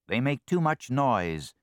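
eager little thief, loud and high; greedy ferret. Acted. Ferret complains.

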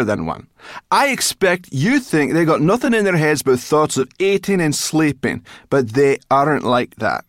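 high, loud, sarcastic rant; furious brownie ridiculing a fool; angry tirade. A narrator's reading. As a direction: rail at them in mounting indignation. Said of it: "She was angry now".

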